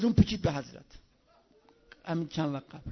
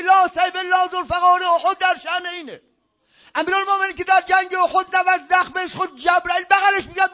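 higher-pitched impassioned sermon build; fervent preaching; 5 semitones higher than usual. Real, not acted. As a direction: build the praise line by line. Preaching at full force, rising.

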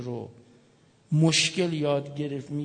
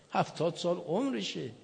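ordinary talk, nothing marked

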